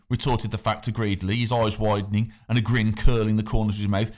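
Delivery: ordinary delivery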